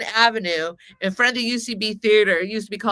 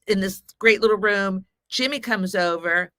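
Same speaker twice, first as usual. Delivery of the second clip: ordinary delivery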